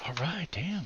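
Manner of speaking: a quick breathy whisper under his breath; hushed, private aside. Real, not acted. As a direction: whisper it to yourself. Muttering to himself mid-game.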